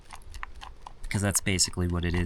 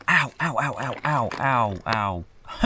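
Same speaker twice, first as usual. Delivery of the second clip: pained yelps; 2 semitones higher than usual. Real, not acted. Spills hot water on himself.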